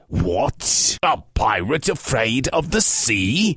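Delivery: low giant voice, wondering; puzzled giant. Acted. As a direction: ask it like a baffled brute. Puzzled giant questions Pete.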